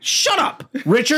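high mock tantrum yell; heated family shouting. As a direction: bicker like a bratty sibling.

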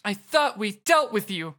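high, put-on hero voice; game hero voice. Acts out a reply in game.

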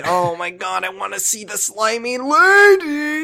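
mock pervy gush; leering. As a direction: ham up an eager creep. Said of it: "I wasn't trying to say".

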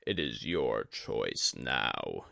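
low, slow, grave teacher's voice; a dying sensei, calm and heavy; Yori the old master. A narrator's reading. Yori speaks to Tadaka.

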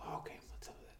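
unvoiced whisper. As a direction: whisper it like a confidence. Guy whispers reply.

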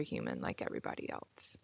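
hushed quick aside; whispering. Real, not acted. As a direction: whisper it gently. Reassuring aside to listeners.

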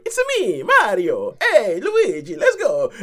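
high, loud, laughing Italian Mario voice. Retold or imitated. Mocking the old game voice, a hammed-up Italian accent. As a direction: ham up a cartoon Italian accent, laughing through it. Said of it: "an Italian stereotype accent".

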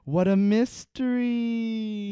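high, loud, slow mock wonder; mock spooky. Host sarcastically mocks the show's mysteries, hammed-up. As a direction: drawl it like a cheesy mystery host.

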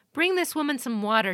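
lady commanding her retainers. A narrator's reading. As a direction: order the servants firmly. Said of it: "she commanded".

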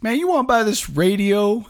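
hammed-up high, loud hustler voice; pushy street seller. Street guy pushing a radio.

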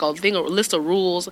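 normal talking voice

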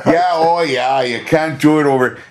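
imitating a Midwestern accent; Minnesota-style mock accent, bright and sing-song. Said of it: "this Midwesty sorta".

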